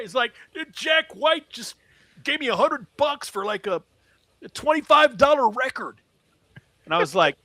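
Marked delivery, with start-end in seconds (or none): 0.5-6.0 high-pitched, incredulous imitation; astonished vendor. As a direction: gush in disbelief, voice pitched way up.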